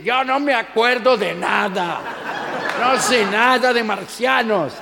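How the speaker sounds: loud whiny denial; mocking suspect voice. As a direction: parrot the suspect with a mocking shout. (imitando al sospechoso a gritos)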